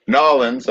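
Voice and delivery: Nollins said as NAH-linz, the New Orleans way; New Orleans pronunciation. Shown as a demonstration. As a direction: say Nollins like a local, two syllables. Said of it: "I wanna say it in your dialect".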